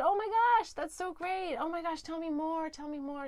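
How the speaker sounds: an over-the-top thrilled oh my gosh; gushing. Retold or imitated. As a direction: squeal with delight at the news. Gushing reply to a friend's story.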